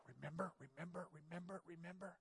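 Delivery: Satan's voice nagging the sinner; taunting whisper. Acted. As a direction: whisper the accusation like a tempter.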